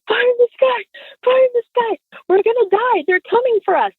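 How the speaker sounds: very high, frantic retold panic; hammed-up terror, squeaky-high but not shouted; mock hysterical. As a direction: imitate a kid in hysterics. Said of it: "I'm in the back hysterically crying".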